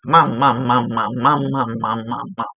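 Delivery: rapid repeated calls, fading off; imitating a kid's insistent droning chant; nagging cartoon kid. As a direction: pester mom like a cartoon child. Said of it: "that little dude on that cartoon on T V".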